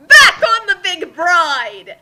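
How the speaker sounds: loud, high excited cry; loud scene announcement. Acted. Reader kicks off the scene with a loud excited call. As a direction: announce the place with gusto.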